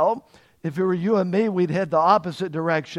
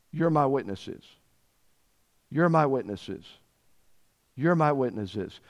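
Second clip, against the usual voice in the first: soft repeated reminder; 5 semitones lower, 5 dB quieter than usual. Acted. Jesus repeating quietly.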